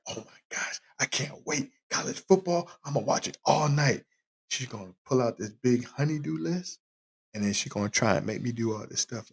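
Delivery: hushed so the wife won't hear; whispered aside to the listeners; whispering. Real, not acted. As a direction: keep it secret, barely voiced. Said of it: "yeah, I'm whispering".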